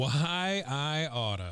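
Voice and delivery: mock tizzy. Listener echoes Green Lantern's empty threat, in a drawn-out mock tizzy. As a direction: stretch out a hammed-up empty threat. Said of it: "Green Lantern in a tizzy".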